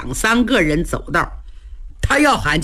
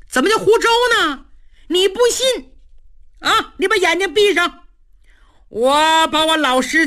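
higher, fast, wheedling; eager bluff; 7 semitones higher than usual. A narrator's reading. Cheng fast-talks the general into closing his eyes, higher and hurried. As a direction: sell the lie quickly.

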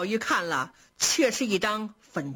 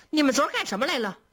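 hurried question; 5 semitones higher, much faster than usual. Acted. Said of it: "平儿忙说".

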